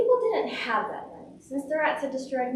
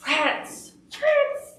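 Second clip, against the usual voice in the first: alarmed townsfolk cry, leaping higher and louder; 13 semitones higher, 4 dB louder than usual. A narrator's reading. Townspeople of Hamelin plagued by rats, acted cry. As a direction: escalate the second shout into a high shriek. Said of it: "they cried".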